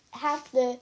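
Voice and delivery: usual speaking voice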